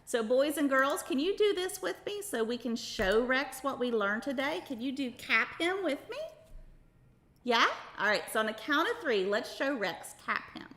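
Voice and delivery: upbeat, sing-song host voice; kids show host. Acted. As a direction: talk brightly to a room of kids. Host addressing children throughout.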